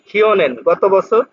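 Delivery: normal talking voice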